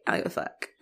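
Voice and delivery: breathy throwaway, whispered and soft; mock drunk Barb. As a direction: toss it off like a drunk who doesn't care.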